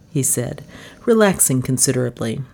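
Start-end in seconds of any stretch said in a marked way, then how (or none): none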